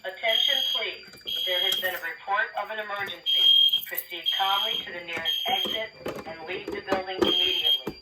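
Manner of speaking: recorded alarm announcement over speakers; calm automated emergency message; evacuation order. Acted.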